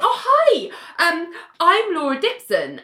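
high, gushing imitation of a greeting; put-on high, eager hello; excited stranger. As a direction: introduce yourself like an overexcited fan. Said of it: "Like, really excited".

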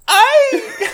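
very high, loud imitated "Ay!" squeal; mock yelp. Imitating prankster as friends pump his chest, mock yelp. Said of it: "With the way he said".

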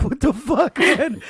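loud, laughing disbelief; shocked outburst. Retold or imitated. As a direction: blurt it out, stunned and amused. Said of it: "And Darren's like".

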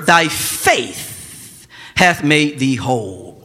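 drawn out, each word of Thy faith hath made thee whole slowed right down; slow scripture reading. Shown as a demonstration. Pastor reading Jesus' words to the church, slow and solemn. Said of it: "He says to her".

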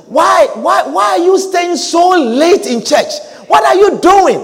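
high-pitched, insistent mock scolding; scolding questioner. Someone questioning a church worker's late nights, mock scolding. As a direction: imitate someone badgering with repeated whys. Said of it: "when someone comes to you and says".

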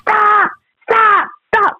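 high, mock shouted stop; a boyfriend waving from shore, mock yelling stop. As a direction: call out the stops, high and urgent. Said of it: "waving his".

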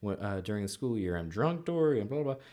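lilting mock small talk from a school teacher; chatty teacher. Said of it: "one of the teachers was saying".

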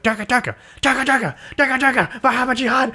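high-pitched angry fake chant, building; mock angry gibberish. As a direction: shout the nonsense words at him, rising. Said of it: "And they're like".

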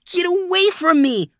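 high-pitched startled cry; shriek. A narrator's reading. Boy startled awake by Desmond's face, shrieking. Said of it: "I shrieked".

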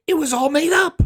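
very high, loud yell; exasperated outburst. Real, not acted. Son telling how mother invented the story, yelling in exasperation. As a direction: burst out in high-pitched disbelief.

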